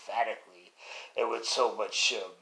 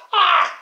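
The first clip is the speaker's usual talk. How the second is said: a sudden high shriek, much louder; mock shriek; 16 dB louder than usual. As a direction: yelp of shock as the head rolls out. Pig's head rolls out.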